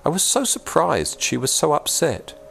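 plain reading voice

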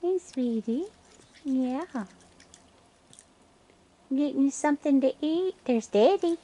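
high, sing-song coo to the animals; tender high voice for a raccoon; sweet pet talk. Real, not acted. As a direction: coo at them like pets. Baby talk to feeding raccoons.

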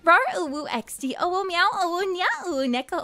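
sing-song kawaii reading; cutesy uwu voice. Shown as a demonstration. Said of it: "I will show you how it is usually done".

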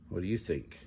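ordinary delivery, nothing marked

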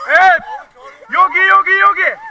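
loud, high cheering call; excited shout. Real, not acted. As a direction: cheer them on loudly. Guide cheering in the water.

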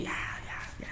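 breathy whispered aside. Retold or imitated. The barber muttering to himself, whispered. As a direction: whisper it to yourself, giving up. Own inner reaction, retold.

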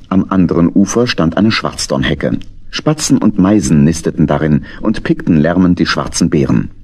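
usual speaking voice

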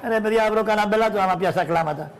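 usual speaking voice

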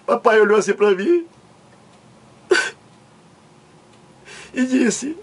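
high, sobbing storytelling with long breaks; hammed-up crying, choked between phrases; tearful breakdown. Man recalling his father's look, mock tearful breakdown. Comic breakdown while telling sad memory.

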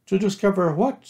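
high rising question, a touch worried; anxious Piglet. A narrator's reading. Piglet questions Pooh about the expedition, mildly anxious. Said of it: "said Piglet anxiously".

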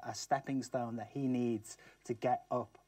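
usual speaking voice

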